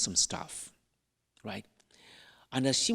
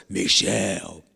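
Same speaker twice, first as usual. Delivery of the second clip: dramatic silly call; 6 semitones lower than usual. Shown as a demonstration. Priest showing how he pranked her, dramatic and silly. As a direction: draw the name out low, being silly. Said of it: "I was being dramatic".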